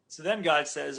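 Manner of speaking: normal talking voice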